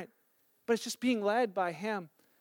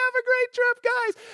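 shouted much higher from a distance; calling out; 4 dB louder than usual. Retold or imitated. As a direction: call it out over traffic as you ride past. Man calls out from passing bike.

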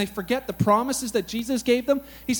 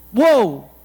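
whoa; 7 dB louder than usual. Real, not acted. Preacher's exclamation.

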